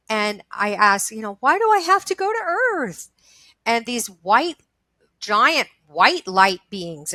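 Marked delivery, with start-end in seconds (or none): 1.4-3.1 high, pleading wail; crying. Retold or imitated. Asking the light beings in her vision, crying. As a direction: re-enact a tearful plea for a reason. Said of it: "I was crying".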